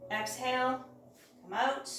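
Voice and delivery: normal talking voice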